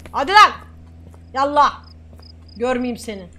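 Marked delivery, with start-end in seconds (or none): 0.1-1.8 loud send-off in role; barked dismissal. Pretend. Officer sending a traveller away, loud dismissal. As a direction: shout them out of the booth.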